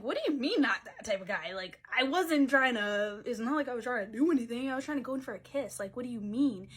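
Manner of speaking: loud, mock-indignant protest; offended retort. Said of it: "Then I get offended and I'm like".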